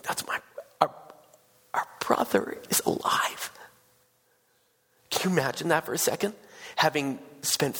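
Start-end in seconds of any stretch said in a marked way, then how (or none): 0.0-3.5 halting, whispered tearful disbelief; acting overwhelmed sisters, voice gone to whisper; imitated bawling. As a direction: choke it out in broken whispers. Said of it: "bawling their eyes out".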